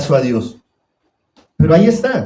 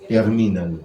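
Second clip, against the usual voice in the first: low, softer mock advice from a worldly father; mock tough father; 6 semitones lower, 6 dB quieter than usual. Preacher mocking a worldly father coaching his son, lower and softer. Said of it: "Yo le enseño a mi hijo".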